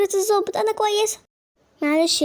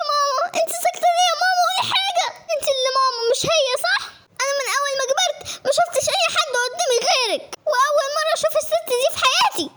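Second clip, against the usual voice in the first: put-on high, crying child's protest; tearful pleading; 8 semitones higher than usual. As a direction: wail desperately at mama to speak up. Child begs mother to deny claim. (احتجاج باكٍ)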